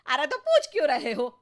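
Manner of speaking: loud, fast irritated retort; wife snapping back at her husband; angry snap. Acted. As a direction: fire it back annoyed and quick. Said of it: "अरे गुस्सा क्यों हो रही हो?".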